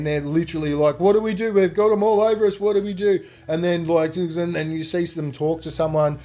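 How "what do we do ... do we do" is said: higher, louder, fast panicked questions; freaking out. Retold or imitated. Leech-covered boys asking what to do, fast panic. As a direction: rattle it off in a panic. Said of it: "they're freaking out, man".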